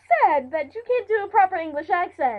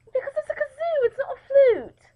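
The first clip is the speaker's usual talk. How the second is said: put-on English accent; 9 semitones higher than usual. Host correcting player about the kazoo, high English accent.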